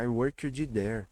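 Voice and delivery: slow "I workedji there"; wrong student pronunciation. Acted. Mock student addressing teacher, worked with extra syllables. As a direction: stretch worked into "uórkedi". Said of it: "Ah, teacher".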